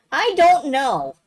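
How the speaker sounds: loud, high, drawn-out protest; exclaimed reply. Acted. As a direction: blurt it out slow and loud. Acted comic line, exclaiming.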